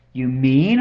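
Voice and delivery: loud storybook surprise; mildly amazed, emphatic; gasped. A narrator's reading. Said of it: "Horton gasped".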